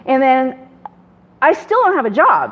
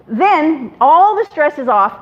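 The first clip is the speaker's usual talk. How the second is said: ordinary talk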